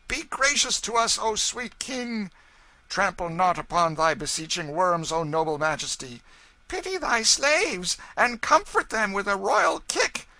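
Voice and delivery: high, loud, hammed-up pleading; mock wailing supplication. A narrator's reading. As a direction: beg like fake worshippers wailing to a king. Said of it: "ironical wailings and mocking supplications".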